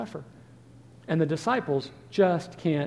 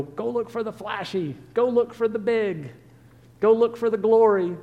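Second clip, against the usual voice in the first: rising, louder voice of eager instinct, high; 6 semitones higher, 4 dB louder than usual. Retold or imitated. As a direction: play the greedy instinct chasing flash. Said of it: "Your instinct is gonna go".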